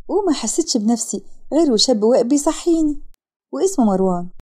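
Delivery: ordinary delivery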